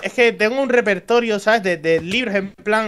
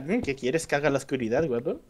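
seductive tease; 8 semitones lower, 9 dB quieter than usual. Pretend. Flirty tease in the dark.

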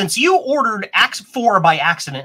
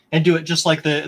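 ordinary talk

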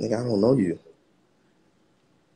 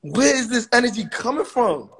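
squealed, very high greeting; high incredulous squeal; high-pitched excitement; 14 semitones higher, slower than usual. Real, not acted. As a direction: push it way up into falsetto. Keeps up the high excited outburst.

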